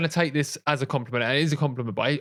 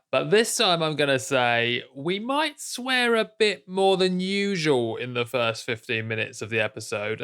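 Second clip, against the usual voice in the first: usual speaking voice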